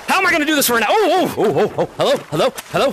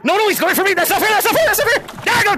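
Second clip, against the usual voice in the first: laughing protest; 8 semitones higher than usual. Real, not acted. Being chased, protesting while laughing.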